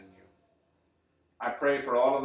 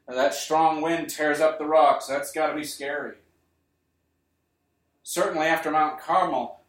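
louder, driving sermon delivery; preaching at full force; 2 semitones higher, 6 dB louder than usual. Acted. Preacher describing wind, quake and fire to the congregation, louder with force. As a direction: preach the storm, build each image. Preacher builds the storm scene.

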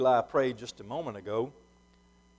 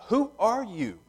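markedly higher, insistent questioning; 8 semitones higher than usual. A narrator's reading. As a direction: press the question while reading Scripture. Questioners press John, Scripture reading.

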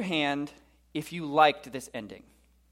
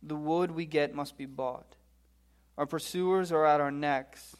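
measured, sombre Bible reading; grave reading with falling line ends; solemn scripture reading; 4 dB quieter than usual. Acted. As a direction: read a lament aloud, gravely. Reads Lamentations aloud to congregation.

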